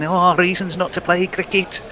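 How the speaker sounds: lightly whiny Liverpool moan; a hint of a whingeing Scouser; whiny Scouse accent. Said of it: "whiny, um, scouse voice in my ear".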